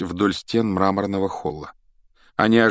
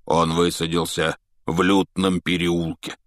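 frowning Hagrid. Acted. Hagrid tells the Weasleys with a frown, grim. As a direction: say it darkly, frowning. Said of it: "сдвинул густые брови Хагрид".

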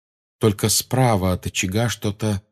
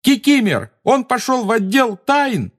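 high, loud shout; 4 dB louder than usual. A narrator's reading. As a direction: shout it at the leaving elf. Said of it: "завопил Гарри".

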